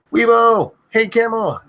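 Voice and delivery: high yelled string of names; screaming names. Acted. Streamer screaming viewers' names in a row, yelling. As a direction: fire off each name as a yell. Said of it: "I end up screaming too much".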